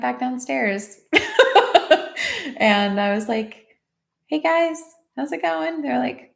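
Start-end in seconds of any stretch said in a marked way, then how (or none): 1.1-2.5 high, loud peal of laughter; real laugh. Real, not acted. Speaker telling of going back downstairs, real high laugh. 4.3-5.8 lightly acted high-pitched hello; light re-enacted greeting. Aunt greeting the boys after crying, high casual greeting. Acts out cheery return to boys.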